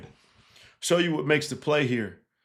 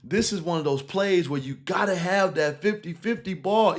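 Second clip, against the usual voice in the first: ordinary talk, nothing marked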